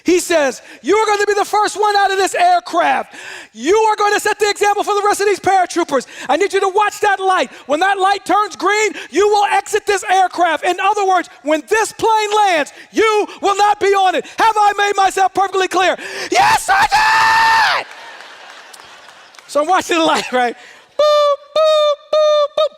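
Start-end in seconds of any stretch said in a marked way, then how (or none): 0.8-15.9 high, shouted military briefing; relentless shouted lecture to a recruit; drill instructor's speech. Retold or imitated. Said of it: "he gives me this speech". 16.3-17.9 very high, long-drawn scream; panicked recruit's comically screamed response; screamed reply. Retold or imitated. Trainee answering shouting instructor. 20.6-22.8 high, even electronic beeps; high-pitched boop sounds; imitated beeping. Imitates the jump light signal.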